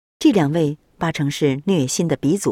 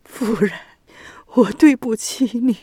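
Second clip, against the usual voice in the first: sobbing; 5 semitones higher than usual. A narrator's reading. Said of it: "已泣不成声".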